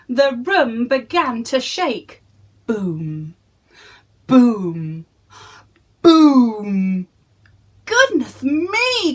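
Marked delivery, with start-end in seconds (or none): 2.7-7.1 booming footsteps. A narrator's reading. Narrator booming the giant's footsteps. 7.9-9.1 high, slow alarmed cry; giantess crying out. Acted. Giantess startled by the shaking room, high cry. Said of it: "cried the giantess".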